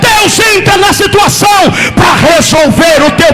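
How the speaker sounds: relentless rhythmic sermon shout; impassioned preacher at full voice; full-force preaching. Real, not acted. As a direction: exhort the congregation phrase after phrase.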